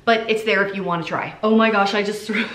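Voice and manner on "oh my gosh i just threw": startled. Real, not acted. Just flung a glass jar.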